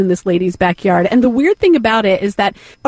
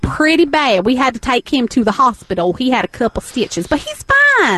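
put-on Southern accent. Tiger lady character.

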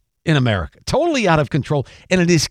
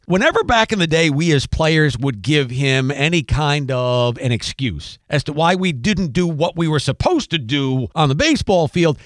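ordinary talk

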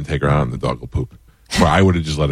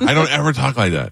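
indignant denial; 7 semitones higher than usual. Real, not acted. Denies the impression of him.

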